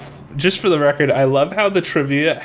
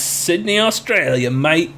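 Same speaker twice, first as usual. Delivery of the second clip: put-on higher, celebratory Aussie accent; Australian accent; 4 semitones higher, slower than usual. Contestant celebrating a right answer, Australian accent.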